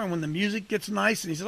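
normal talking voice

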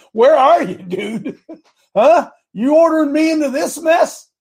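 high, loud, half-laughing protest to the Lord; mock complaint to God. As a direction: throw up your hands at God, playfully exasperated.

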